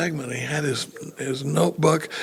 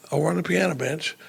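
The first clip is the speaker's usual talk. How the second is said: ordinary delivery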